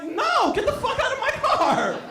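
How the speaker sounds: high-pitched, panicky comic protest; hammed-up high indignation; alarmed refusal. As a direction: squeal it at an intruder in your car. Acted refusal to intruder in car.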